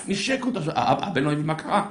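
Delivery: normal talking voice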